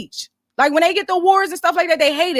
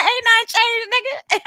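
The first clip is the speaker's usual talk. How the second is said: laughing; 9 semitones higher than usual. Real, not acted. Laughing brag.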